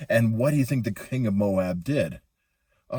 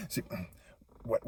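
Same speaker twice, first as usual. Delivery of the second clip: usual speaking voice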